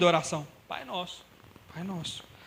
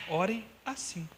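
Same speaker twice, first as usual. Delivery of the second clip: slow corrected quote; 4 dB quieter, slower than usual. Acted. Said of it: "Ele não disse".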